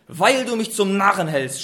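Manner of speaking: loud angry outburst; shouted. A narrator's reading. Biliam shouts furiously at his donkey. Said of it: "schrie Biliam".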